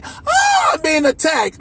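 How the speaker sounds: high mock cry for help; mock victim cry. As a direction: sneer it like a fake victim.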